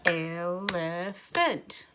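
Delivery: ELEPHANT in three slow, separate beats; robot ELEPHANT, one drawn-out syllable per clap; robot talk. Shown as a demonstration. Teacher clapping the syllables with children, slow robot ELEPHANT. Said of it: "like we are a robot".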